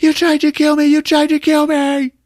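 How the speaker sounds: high, loud, wailed accusation; hammed-up screech, stretching the last words; furious yelling. Son accuses mother of trying to kill him, yelling. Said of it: "So they're yelling at each other".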